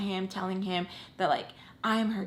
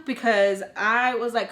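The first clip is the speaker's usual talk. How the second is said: plain reading voice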